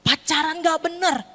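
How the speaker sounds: high, wailing acted exasperation; fed-up parent. Parent lamenting a son's bad dating, exasperated. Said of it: "kesel banget jadi orang tua".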